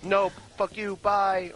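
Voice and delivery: cheeky. Retold or imitated. Kid mouthing off, running away.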